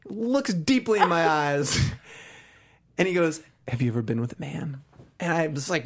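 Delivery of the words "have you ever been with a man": low, hushed imitation of a man's advance; much lower, confidential role voice; intimate come-on. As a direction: drop the voice for a mock-seductive question. Man gazes deeply, propositions him.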